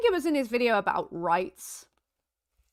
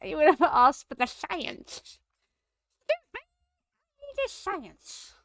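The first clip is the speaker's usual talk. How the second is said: mocking voice; 10 semitones higher, slower than usual. Mocking the poster's "science.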